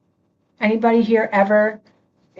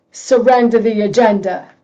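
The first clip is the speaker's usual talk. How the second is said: put-on a light, louder New York Long Island accent; 6 dB louder than usual. Preacher playing with a listener's Long Island accent. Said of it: "in your Long Island accent".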